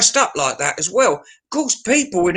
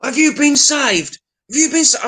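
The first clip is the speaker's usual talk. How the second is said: preacher bloke; 10 semitones higher, 3 dB louder than usual. Retold or imitated. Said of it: "he goes".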